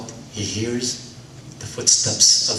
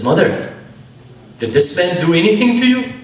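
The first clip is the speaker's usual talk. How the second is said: high, loud, protective outburst; alarmed shout; 8 semitones higher, 5 dB louder than usual. Acted. Son shouting at the stranger to his mother, alarmed. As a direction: son bursts in demanding answers.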